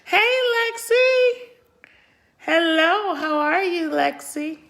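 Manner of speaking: high, slow, sing-song hello; light up greeting a friend; excited greeting. Real, not acted. Greeting a viewer in chat.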